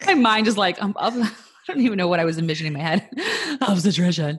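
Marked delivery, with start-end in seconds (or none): 3.4-4.4 laughing. Real, not acted. Laughing at own mispronunciation.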